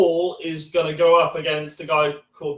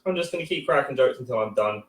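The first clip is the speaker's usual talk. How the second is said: normal talking voice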